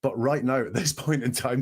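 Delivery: ordinary talk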